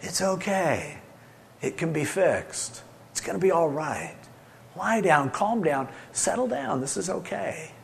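soft, calm and gentle; a dad calming his panicked family, softly reassuring; soothing reassurance. Shown as a demonstration. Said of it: "communicate that idea".

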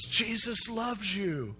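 high, drawn-out mock yell. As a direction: stretch it out high without real volume. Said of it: "And he just yells".

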